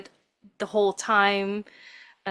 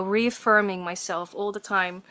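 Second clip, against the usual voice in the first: ordinary delivery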